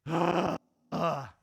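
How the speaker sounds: loud exasperated groans; kid's groan. Retold or imitated. Preacher imitating kids groaning at their parents. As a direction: groan like a fed-up child. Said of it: "two kids going to mom and dad and going".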